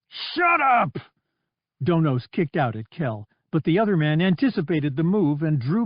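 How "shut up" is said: much higher, sharp shout; furious outburst. Acted. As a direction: cut him off hard. Donos lashing out at Kell.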